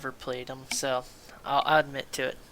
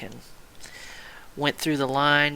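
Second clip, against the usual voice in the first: usual speaking voice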